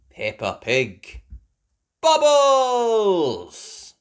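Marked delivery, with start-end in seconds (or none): 2.0-3.9 high, loud sing-song title; excited title call. Acted. Host announcing the story title, bright and loud. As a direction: announce it like a treat for kids.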